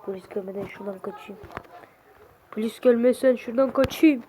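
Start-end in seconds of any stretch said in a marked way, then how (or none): 0.0-1.4 low, very soft, quick mutter; hushed aside. Acted. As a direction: mutter the escape plan to yourself. Shooter plans escape, two takes.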